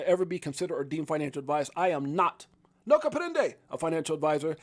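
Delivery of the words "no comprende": higher joke Spanish aside; playful Spanish accent; mock Spanish. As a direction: toss it off in fake Spanish.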